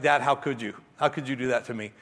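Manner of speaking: a touch of hurt, lightly voiced; disappointed. Son learning the autograph was a prank, mildly hurt. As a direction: mildly let down son, understated. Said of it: "he looked at me disappointed".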